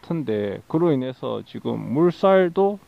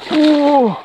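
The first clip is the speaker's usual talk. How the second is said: high, loud burst of surprise; surprised shout; 11 semitones higher, 7 dB louder than usual. Real, not acted. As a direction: let out a thrilled gasp. Big fish appears.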